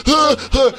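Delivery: high little squeaks; weak squirrel squeaks. Retold or imitated. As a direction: squeak faintly like a dazed squirrel. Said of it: "just like".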